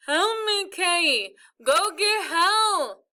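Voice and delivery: high, loud, very slow sobbing plea; crying plea. Acted. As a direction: beg through tears. Crying brother stuck to mirror.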